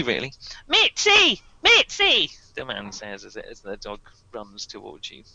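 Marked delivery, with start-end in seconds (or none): 0.7-2.3 much higher frantic calling; calling after a dog. Acted. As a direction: shriek the dog's name after it. Owner calling runaway poodle.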